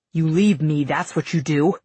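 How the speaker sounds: low growl forced through clenched teeth. A narrator's reading. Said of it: "Shade growls through clenched teeth".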